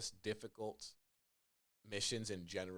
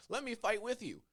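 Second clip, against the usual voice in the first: eager escort; 13 semitones higher, 3 dB louder than usual. Retold or imitated. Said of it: "the person you're escorting is like".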